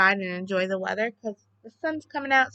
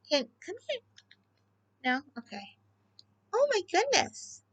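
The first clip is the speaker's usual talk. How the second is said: high, sing-song cooing at a bunny; 8 semitones higher than usual. Real, not acted. Owner coaxing her hyper pet bunny over, high cooing baby talk.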